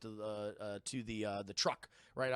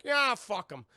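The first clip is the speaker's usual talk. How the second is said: loud, high mock scoff; dismissive; 6 semitones higher, 7 dB louder, slower than usual. Imitating the parents' uncaring attitude, loud dismissal. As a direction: brush it off loudly, mock callous.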